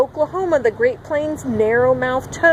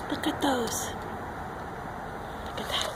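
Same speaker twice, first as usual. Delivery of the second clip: hushed; 12 dB quieter than usual. Real, not acted. Quiet wonder at picture.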